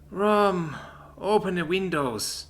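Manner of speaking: half-asleep call, drawn out slowly; sleepy. A narrator's reading. Mother calling from bed half-asleep, sleepy. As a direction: mumble it as if barely awake.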